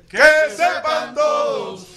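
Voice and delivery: group shout. Shown as a demonstration. The table shouting the slogan on cue, a loud slow chant. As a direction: yell it all together, drawn out. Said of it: "Que la mesa me mande un que sepan todos".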